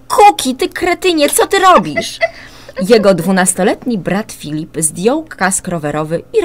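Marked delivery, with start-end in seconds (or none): 0.1-2.2 put-on loud, much higher outraged boy's yell; angry boy's shout. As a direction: scold your little brother at the top of your voice. Brother hit on the head. (głośny, wysoki, oburzony krzyk chłopca)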